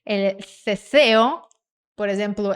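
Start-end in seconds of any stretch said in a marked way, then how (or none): 0.1-1.4 "El ceseo" slow, with th for c and s; tongue between the teeth on "ceseo"; Castilian lisped C. Shown as a demonstration. Teacher imitating Spain's speech, "ceseo" slow with th sounds. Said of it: "eles falam com a língua entre os dentes".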